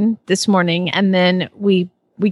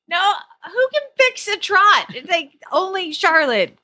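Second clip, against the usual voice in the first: mock disbelief; 11 semitones higher than usual. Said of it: "I was like".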